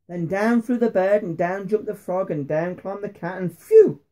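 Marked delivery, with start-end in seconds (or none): 3.6-4.0 high, loud phew; relieved exclamation. A narrator's reading. Story dog voices relief.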